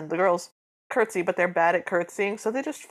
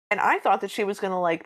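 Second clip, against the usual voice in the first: ordinary delivery, nothing marked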